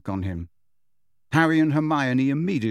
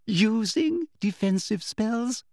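amused disbelief; 11 semitones higher, slower than usual. Acted. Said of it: "repeated with a little laugh".

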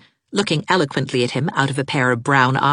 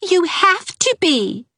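stubborn; 4 dB louder, slower than usual. Acted. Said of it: "said Ivy stubbornly".

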